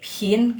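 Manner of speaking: "pin" with air released after the p; aspirated P. Shown as a demonstration. Teacher repeating pinyin P for students, with aspiration. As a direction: puff out the p in "pin". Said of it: "Ada napasnya".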